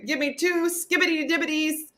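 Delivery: high, assured nonsense order; high mock-confident old man; confident grandpa. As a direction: order calmly like it's normal. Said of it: "he was like".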